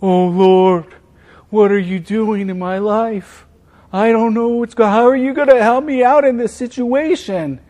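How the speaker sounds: a hammed-up whiny plea to the Lord; whiny complaint. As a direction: whine at God like a fretful child. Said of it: "I have begun to whine and complain".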